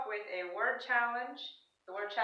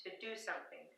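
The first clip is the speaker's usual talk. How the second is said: ordinary delivery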